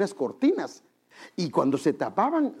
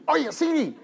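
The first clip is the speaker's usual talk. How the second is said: high call to Siri; 13 semitones higher, 3 dB louder, slower than usual. Retold or imitated. Man talking loudly to devices.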